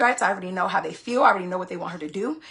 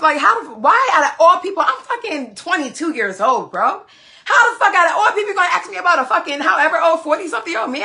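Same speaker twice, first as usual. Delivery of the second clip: loud, fast, higher-pitched outrage; outraged rant; 8 semitones higher, 9 dB louder than usual. Real, not acted. Telling viewers about the stranger, loud outraged rant. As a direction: let the disbelief explode into a yell.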